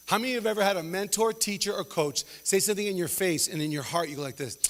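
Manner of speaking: ordinary talk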